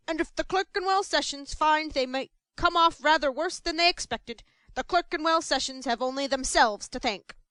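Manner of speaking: loud, threatening pronouncement; menacing. Acted. Mr Bumble threatening the Clerkenwell sessions, loud and menacing. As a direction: menace them with every word. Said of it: "menacing manner".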